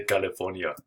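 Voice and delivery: California said as "Kalifornia" with a mock Austrian accent; Arnold Schwarzenegger. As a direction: lightly mimic an Austrian mispronouncing California. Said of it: "he can't even say California".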